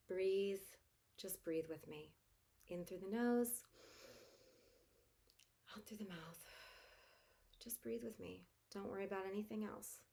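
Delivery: soft, calm breathing cue; guided breathing. Shown as a demonstration. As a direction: breathe them down gently. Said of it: "all I want you to do right now".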